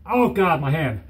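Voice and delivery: ordinary delivery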